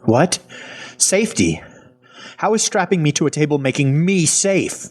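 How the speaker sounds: high-pitched incredulous questioning; indignant disbelief. Acted. Restrained man challenges the woman's explanation.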